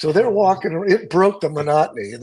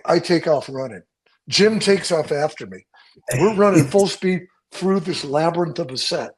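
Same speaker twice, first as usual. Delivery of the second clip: usual speaking voice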